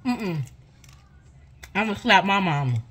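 speaking between bites; talking while chewing. Real, not acted. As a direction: mouth partly full. Seen 1: Bites food at -3; puffed cheeks and chewing mouth while speaking.

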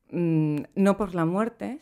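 ordinary talk

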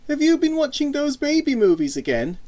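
put-on high-pitched girl's voice; teasing older sister. A narrator's reading. Older sister mocking her brother's films, teasing. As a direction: needle your little brother in a high voice.

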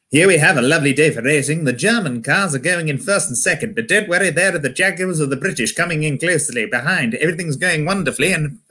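breezy, lilting posh newsreel commentary; British race commentator. Acted. Commentator at Le Mans race, cheerily underplayed posh British commentary. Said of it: "the commentary goes something like this".

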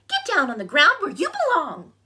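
high-pitched scolding squawk; squawking bird. A narrator's reading. Bird sending bear down from tree, squawking. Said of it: "squawked the bird".

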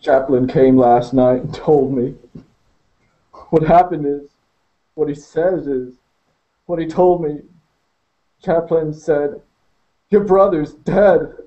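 telling the news as it breaks him; wailing. Acted. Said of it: "he wailed".

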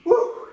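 high excited woo; coach whoop. Real, not acted. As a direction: let out a whoop. Coach cheering.

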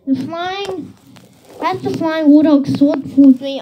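ordinary delivery, nothing marked

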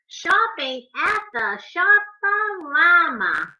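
animated picture-book reading; reading to children. A narrator's reading. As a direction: read the rhymes to little kids, bouncing on the beat.

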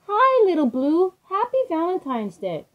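high, cheery greeting to the engine; friendly station manager. A narrator's reading. Station manager greets the little engine, high and cheery. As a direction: call out a bright hello.

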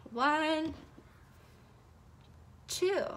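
usual speaking voice

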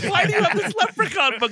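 very high, loud incredulous question; incredulous squeal. Retold or imitated. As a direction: squeal it up in mock outrage. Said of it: "And it's like".

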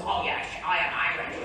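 comic impression of an unchanging Scottish star; deadpan Scottish accent claiming to be Irish; mock film-star voice with "yesh" for "yes"; Sean Connery. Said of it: "when he was playing an Irishman".

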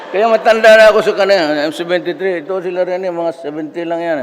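loud, fast, delighted boast; excited. Real, not acted. Telling listeners proudly about his age, loud and fast.

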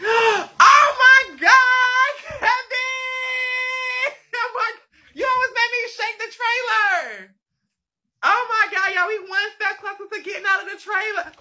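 squealing with joy, very high; excited screaming. Real, not acted. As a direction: shriek and laugh with delight. Overjoyed at news, shaking trailer.

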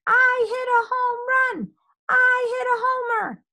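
high, loud triumphant holler. A narrator's reading. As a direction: shout the good news to all. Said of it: "he hollered".

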